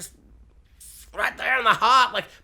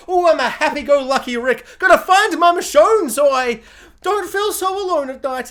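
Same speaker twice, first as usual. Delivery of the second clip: higher, louder mock Rick, chirpy and carefree; happy-go-lucky Rick; 8 semitones higher, 6 dB louder than usual. Vlogger mocks Rick searching for Michonne, higher chirpy voice. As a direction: play Rick as a cheerful lonely guy, higher and louder. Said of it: "He's all".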